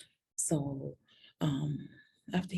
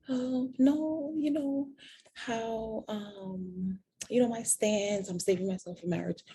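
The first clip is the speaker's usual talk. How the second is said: raised, bright retelling of saying no; mock-flustered refusal, higher; high mock protest; 7 semitones higher, slower than usual. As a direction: replay your own flustered no, pitched up. Retelling own refusal to boyfriend.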